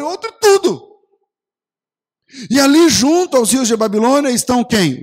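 loud, rising preacher's climax; preaching at full force. Acted.

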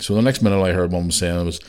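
ordinary talk

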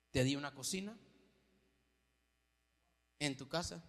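low, soft deadpan; understated divine deadpan; deadpan God punchline. Acted. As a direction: drop it flat for the joke. God's punchline, lower.

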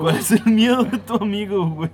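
playing quiz host to friends on the podcast; mock game-show host.

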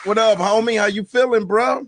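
loud, high hype-man welcome; hyped greeting. Real, not acted. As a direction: greet him big and loud.

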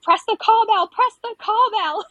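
high, loud urgent call; imitating a midwife yelling at the husband to press the bell; retold yell. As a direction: shout it at the husband to hurry. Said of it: "yelling at my ex-husband".

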